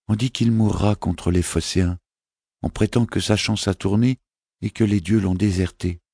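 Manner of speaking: a small prince asking his mother; child's voice, lifted and innocent; young boy voice. Acted. Boy repeats rumours.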